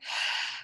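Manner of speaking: soft breathy happy sigh; demo sigh. Shown as a demonstration. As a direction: let out a content sigh. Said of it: "sighing's like".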